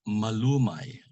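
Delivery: MALUMAY with a long, stressed LU; penultimate stress. Shown as a demonstration. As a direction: stretch LU in MALUMAY, then let it fall. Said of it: "accent is on the second to the last".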